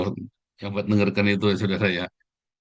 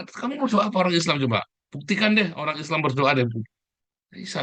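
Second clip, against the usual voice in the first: ordinary talk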